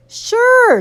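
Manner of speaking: put-on high, chirpy little-boy reply; breezy agreement. A narrator's reading.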